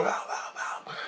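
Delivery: breathy whispered cry for help; whispered pleas for help. Retold or imitated. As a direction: whisper it, desperate. People outside begging to come in.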